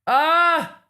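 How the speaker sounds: short high yelp of frustration; frustrated yelp. Real, not acted. Streamer yelping at the lawyer's insinuation, frustrated. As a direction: let out a sharp exasperated cry.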